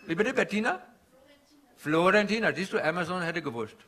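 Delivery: put-on comic Indian-accented stand-up guru.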